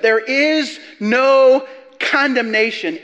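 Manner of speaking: "no" stretched slowly and held on a falling line; slow down and lean hard on "no"; emphasis on no. Shown as a demonstration. Preacher stressing no condemnation to the congregation, slow and firm.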